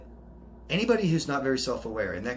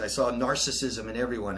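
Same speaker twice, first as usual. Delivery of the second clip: ordinary delivery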